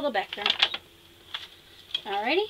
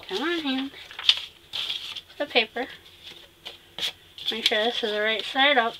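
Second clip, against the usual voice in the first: pen in mouth. Real, not acted. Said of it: "Talking with a pen in my mouth".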